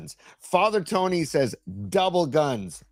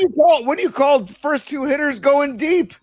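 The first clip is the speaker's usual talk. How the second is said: loud, high mocking question; indignant loud sports-radio rant; heated sarcastic question; 10 semitones higher, 8 dB louder than usual. Real, not acted. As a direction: throw it at him, loud and high.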